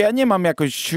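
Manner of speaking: ordinary delivery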